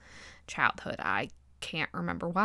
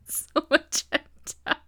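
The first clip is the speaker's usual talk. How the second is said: giggling; 8 semitones higher, slower than usual. Real, not acted. Laughing while resuming the story.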